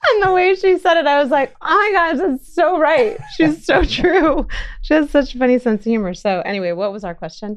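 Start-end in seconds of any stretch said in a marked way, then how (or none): 0.1-6.1 high, loud, laughing delivery; delighted and loud, laughter breaking in; real amusement. Real, not acted. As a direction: tell it while cracking up, voice pitched way up. Cracking up over daughter's joke.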